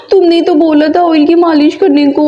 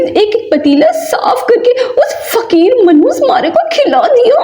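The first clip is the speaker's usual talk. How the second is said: high, teary complaining; crying rant; 7 semitones higher than usual. Acted. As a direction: rant through comic tears. Mother's teary rant at kids. (ऊँची आवाज़ में रोते हुए शिकायत)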